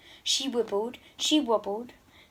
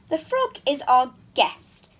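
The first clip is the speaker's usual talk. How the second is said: louder stern king; king's bellow; 4 semitones higher, 6 dB louder than usual. Acted. King booms at his daughter about the guest. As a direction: boom it down the dinner table. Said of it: "bellowed the king".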